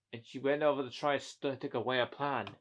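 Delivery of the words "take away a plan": ordinary delivery, nothing marked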